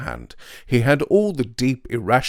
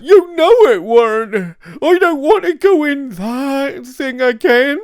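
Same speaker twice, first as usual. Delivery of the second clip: sobbing plea; 13 dB louder than usual. A narrator's reading. Half-wit pleads sobbing.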